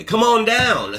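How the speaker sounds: high, loud TV car-ad pitchman call; imitating the dealership ad on TV; Ernie Bach ad impression. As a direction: belt it like the local dealer's TV jingle. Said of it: "If anybody's from Massachusetts or New England, they know that".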